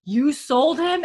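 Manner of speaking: high, loud incredulous cry; mock-outraged yelp of disbelief; shocked outrage. Bree's outraged retold question.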